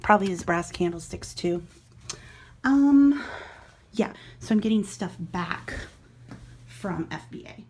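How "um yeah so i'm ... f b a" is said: a bit out of breath from hauling boxes; breathless after carrying boxes. Real, not acted. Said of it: "Sorry, I'm out of breath".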